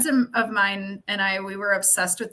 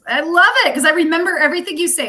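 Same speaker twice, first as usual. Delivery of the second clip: excited; 6 semitones higher, 8 dB louder, faster than usual. Real, not acted. Excited about accents.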